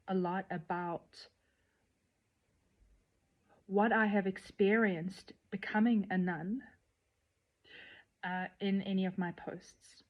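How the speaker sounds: normal talking voice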